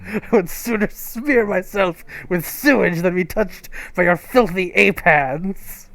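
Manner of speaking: put-on haughty cat with a half-managed French accent; attempted French accent. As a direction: try for French disdain, accent barely there. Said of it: "I can't really do a French accent".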